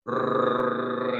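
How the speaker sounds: rolling rrr like a fridge motor starting; imitated motor hum. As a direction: buzz the lips like an old motor kicking in. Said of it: "cuando arrancan su motor".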